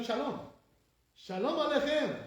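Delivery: high-pitched hail; declaimed greeting. Acted. Teacher addressing the angels, high declaimed greeting.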